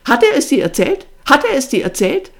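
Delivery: loud, high venomous demand; spiteful. A narrator's reading. Sister grilling her brother about the visitor, spiteful. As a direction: fire the question out twice with spite. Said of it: "zischte sie gehässig".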